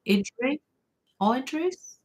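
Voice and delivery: IDRIS tried haltingly, rising high as a question; unsure pronunciation. Real, not acted. Streamer trying a viewer's name, IDRIS said unsurely. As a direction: stumble on IDRIS, then lift it questioningly. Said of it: "I can't say it".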